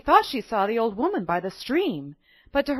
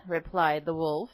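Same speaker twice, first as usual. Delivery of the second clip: plain reading voice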